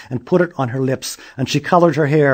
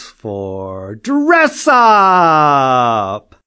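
stretched ending; 3 semitones higher, 8 dB louder, much slower than usual. A narrator's reading.

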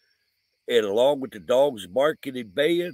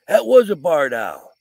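louder, higher insistent retort; insistent correction; 4 semitones higher, 8 dB louder than usual. Retold or imitated. Rosie correcting him about the barn owl, louder and insistent. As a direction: set him straight, loudly. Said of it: "Rosie goes".

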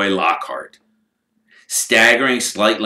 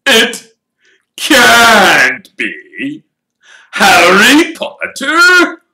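excited shout; 13 semitones higher, 11 dB louder, much slower than usual. Acted. Crowd member shouts on sighting.

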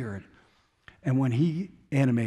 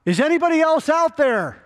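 high, loud panicked yell for anyone else; man refusing God's offer, yelling for anyone else; desperate yell; 6 dB louder than usual. Retold or imitated. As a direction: shout it up in comic desperation. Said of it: "and he yells out".

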